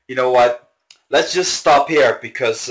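usual speaking voice

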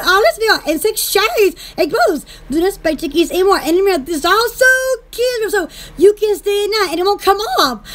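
normal talking voice

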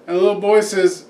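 normal talking voice

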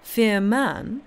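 colonel's mildly incredulous "Fear man?"; polite incredulity. A narrator's reading. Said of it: "raised his eyebrows politely".

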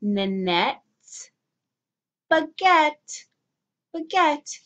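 baguette said clearly, stressed on the end; modelled for repeating. Shown as a demonstration. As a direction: model baguette slowly for kids to copy.

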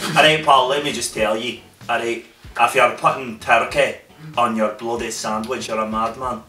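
emphatic mock Scottish telling-off; Scottish accent. Scot telling Paul off over a sandwich, mock-indignant Scottish accent. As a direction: scold a pal as a put-on Scot.

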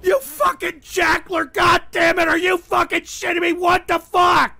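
high, loud enraged yelling; furious shout. Real, not acted. Streamer yelling at his mod Jackler, furious high shout. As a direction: scream at the betrayal.

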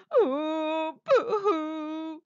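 mock crying. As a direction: wail a pretend boo-hoo. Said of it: "Amelia cried huge, giant tears".

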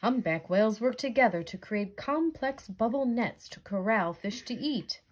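plain reading voice

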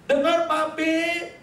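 put-on high, long-drawn child voice; stretched, high-pitched child's call; daughter's loud reply. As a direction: answer like a little girl who speaks too loudly. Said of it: "dia bilang begini".

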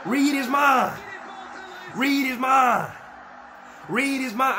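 yelling at the screen; excited shouting. Real, not acted. Hyping goalkeeper chant at screen.

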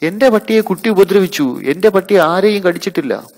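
very high, loud indignant shout; dog owner shrieking high and loud in protest. Acted. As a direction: defend your dog at full volume. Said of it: "പട്ടിയുടെ ഉടമസ്ഥ വിളിച്ചു കൂവി".

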